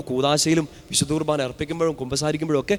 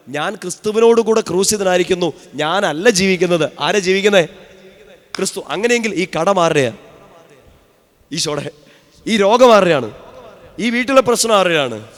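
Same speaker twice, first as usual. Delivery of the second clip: raised preaching voice, louder, calling out; 2 semitones higher, 5 dB louder than usual. Acted. Preacher driving home the verse to the congregation, loud fervent preaching. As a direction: proclaim it to the congregation with fervour.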